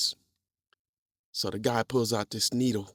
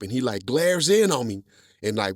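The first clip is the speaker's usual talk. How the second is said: normal talking voice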